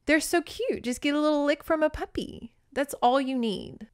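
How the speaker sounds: high, light puppy-adoring voice; playful acted sweetness; cooing character quote. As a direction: coo as if over a tiny puppy. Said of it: "where she's like".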